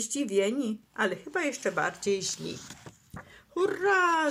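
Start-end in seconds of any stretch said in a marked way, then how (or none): 3.5-4.3 high, drawn-out hooray; joyful cheer. A narrator's reading. Turtle jumps for joy at the news, cheering. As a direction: burst out cheering. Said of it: "zawołał Franklin i podskoczył z radości".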